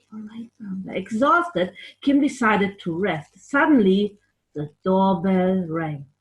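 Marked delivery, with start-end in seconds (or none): 0.1-0.9 whisper. Acted.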